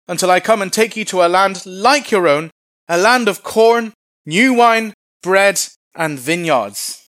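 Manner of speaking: high, loud herald's proclamation; calling out. Acted. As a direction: shout it to a crowd on a city wall. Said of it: "stood and called out in Hebrew".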